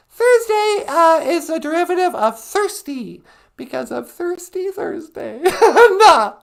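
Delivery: very high squeaky puppet wife greeting. Acted. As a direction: chirp it in a tiny high voice.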